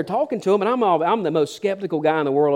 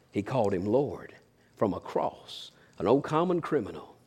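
low, much softer voice with pauses; hushed awe; 7 semitones lower, 9 dB quieter than usual. Acted. Preacher on the thief calling Jesus Lord, hushed awe. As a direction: drop right down and let each phrase land quietly. Awed climax of the thief story.

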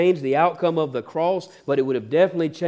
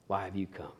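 much softer and lower, almost breathed; hushed repeat; 6 semitones lower, 13 dB quieter than usual. Acted. Jesus repeats the question to Judas, hushed and low. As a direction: let the repeat fall to a hush.

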